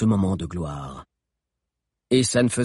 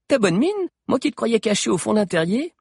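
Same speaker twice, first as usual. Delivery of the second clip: higher, playful teasing; playful girl; 11 semitones higher than usual. A narrator's reading. A girl teases her friend about hiding, high and playful. Girls' excited reunion.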